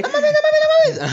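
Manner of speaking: real shocked squeal. Real, not acted. Listener reacts to story.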